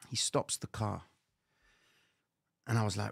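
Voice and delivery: ordinary delivery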